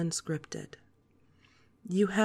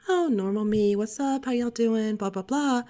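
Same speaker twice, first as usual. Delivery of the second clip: higher, louder, breezy upbeat greeting; normal chatty me; 5 semitones higher, 4 dB louder than usual. Shown as a demonstration. Host demoing her usual chatty greeting, higher and louder. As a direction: toss it off like a bubbly hello to friends. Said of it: "hear the difference between the".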